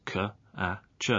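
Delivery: k, a, ch. as three isolated phonemes; separate sounds. Shown as a demonstration. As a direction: softly sound out k, a, ch. one at a time. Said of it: "there are only three sounds".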